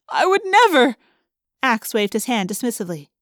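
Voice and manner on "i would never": high, loud desperate protest; terrified moan. A narrator's reading. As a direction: wail it, pleading for mercy. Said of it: "the woman moaned".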